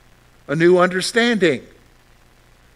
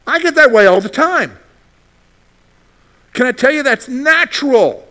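much louder and higher, full-force emphasis; loud preaching; 6 semitones higher, 6 dB louder than usual. Real, not acted. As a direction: proclaim it to the whole room. Preacher confessing to congregation.

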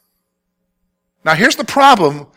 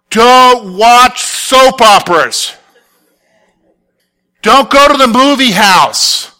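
slow, loud, higher mock rule-giver with a laugh; mock preacher rules; 5 semitones higher, 5 dB louder, slower than usual. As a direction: ham up the old church rules, drawn out and laughing. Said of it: "remember this one?".

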